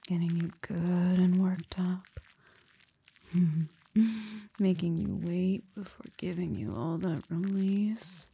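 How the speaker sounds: ordinary delivery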